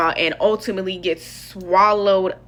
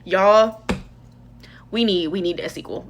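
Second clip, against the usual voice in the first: ordinary delivery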